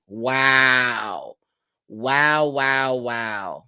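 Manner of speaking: drawn-out, sarcastic wows; mock being impressed. Real, not acted. Sarcastic reply to commenter.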